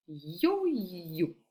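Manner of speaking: "yo-yo" drawn out, syllable by syllable; slow dictation. Shown as a demonstration. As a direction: stretch both halves of "yo-yo" for spelling. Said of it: "Je vais te lire".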